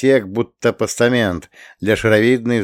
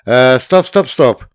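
loud, raised call to halt; exclamation; 4 semitones higher, 7 dB louder, slower than usual. A narrator's reading. Planetologist halts the others, exclaiming. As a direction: cry out to stop everyone. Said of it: "воскликнул планетолог".

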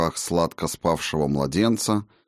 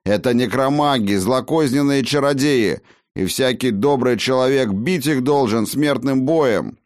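high, raised, indignant condemnation; angry tirade; 6 semitones higher, 3 dB louder than usual. Acted. Master warning his wife against necromancers, railing angrily. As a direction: rail at them as evil sorcerers.

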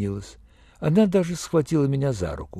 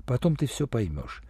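softer, understated reassurance; quiet; 3 semitones lower, 7 dB quieter than usual. A narrator's reading. She puts off explaining to the narrator, quietly. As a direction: lower the volume and keep it gentle. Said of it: "негромко сказала она".

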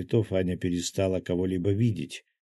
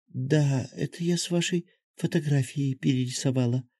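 put-on a higher child's voice, proud; lightly voiced child, pleased with herself; pleased little girl; 6 semitones higher than usual. A narrator's reading. Little girl shows her portrait to Anya, proud. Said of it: "довольно заявила малышка".

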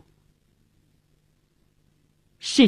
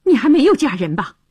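urgent, raised-pitch demand; abrupt, pressing question; blunt questioning; 4 semitones higher, 4 dB louder, faster than usual. A narrator's reading. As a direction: confront them with the question straight off. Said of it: "劈头就问".

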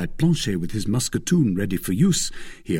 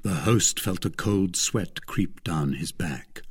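usual speaking voice

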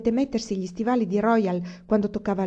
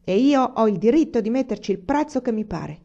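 loud, raised, emphatic assertion; angry insistence; 5 semitones higher, 5 dB louder than usual. A narrator's reading. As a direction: lay down the law, pounding each point. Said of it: "Picchiò il pugno sul tavolo e disse".

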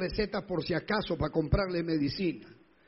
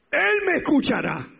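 even higher, louder repeat of "Él me escuchará"; climactic shout of faith; 10 semitones higher, 10 dB louder than usual. Acted. Preacher repeating the verse at the climax, ringing shout. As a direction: top the first line, shout it to the heavens. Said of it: "el Salmo setenta y siete".

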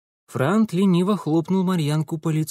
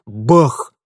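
sudden bang; 2 semitones lower, 8 dB louder than usual. A narrator's reading. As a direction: punch it out like a crash. Narrator imitates a crash. (громкий удар)

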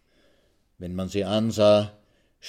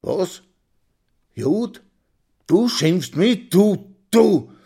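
high, disbelieving, building to a loud threat; indignant fury; 11 semitones higher, 8 dB louder, much slower than usual. A narrator's reading. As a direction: draw out the words as the outrage builds. Insulted man confronts his attacker. (fassungslos empört, drohend)

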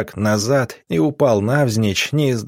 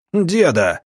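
high, mildly raised call; alarmed cry; 7 semitones higher than usual. A narrator's reading. Villager crying out to the wounded old man, mild shout. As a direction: call out higher, a contained narrated shout. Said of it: "крикнул кто-то из мужиков".

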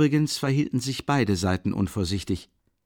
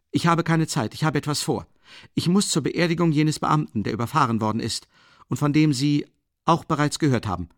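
irritated; 6 semitones higher, 4 dB louder than usual. A narrator's reading. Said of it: "mit ziemlich starker Gereiztheit".

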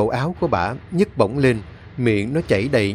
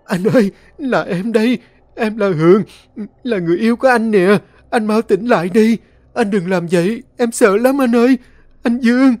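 high, loud tearful pleading; frightened girl begs her lover, crying loudly; 9 semitones higher, 6 dB louder than usual. Acted. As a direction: wail it through tears, terrified. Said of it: "khóc toáng lên".

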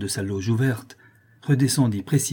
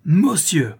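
loud, higher exclamation; thundering shout up the stairwell; stentorian cry; 6 semitones higher, 5 dB louder than usual. A narrator's reading. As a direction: boom it out in outrage. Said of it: "s'écria-t-il d'une voix de stentor".

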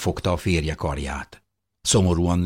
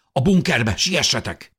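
raised, higher hurrying call; urgent shout; 7 semitones higher, 3 dB louder than usual. A narrator's reading. Father sends family to the bunker, shouting urgently. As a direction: order everyone to hurry. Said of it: "kiáltotta Fischer úr".

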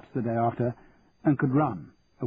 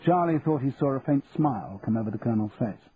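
ordinary delivery, nothing marked

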